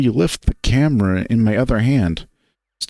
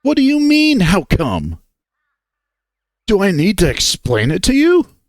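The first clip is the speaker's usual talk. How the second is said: higher, louder angry outburst; getting angrier; 8 semitones higher, 3 dB louder than usual. A narrator's reading. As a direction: snap back in rising anger. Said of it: "I feel myself getting angrier".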